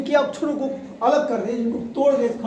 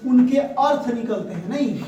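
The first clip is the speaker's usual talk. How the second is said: ordinary talk, nothing marked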